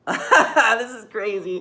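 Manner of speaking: slow, loud laugh through the words. Real, not acted. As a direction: laugh it off, dragging it out. Laughing at own rant.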